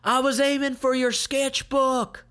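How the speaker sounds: high, loud taunt shouted back; mock yell. Sai yells a taunt while running off, high and loud. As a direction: fling a cheeky parting shot.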